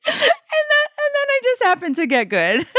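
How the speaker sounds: high-pitched, squeezed out through laughter; laughing confession. Real, not acted. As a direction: barely get it out while cracking up. Cracking up at her own confession.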